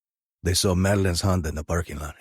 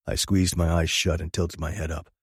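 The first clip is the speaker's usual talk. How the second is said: plain reading voice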